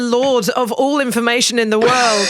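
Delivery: bad Australian accent as lord of all information; mock Australian accent. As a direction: play a pompous all-knowing Australian. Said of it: "put that Australian accent on again".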